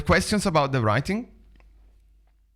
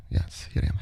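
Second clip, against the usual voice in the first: whispered, softer aside; 6 dB quieter than usual. Real, not acted. Host revealing herself to viewers, whispered aside. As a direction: breathe it out as a secret aside.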